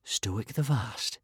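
plain reading voice

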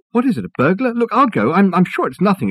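high, anxious and insistent; agitated. A narrator's reading. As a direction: rush to reassure, worried. Richard anxiously insists on going.

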